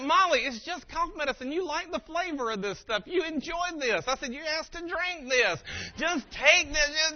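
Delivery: high, insistent, mounting exasperation; exasperated dad. Retold or imitated. Dad urging daughter to take medicine, exasperated.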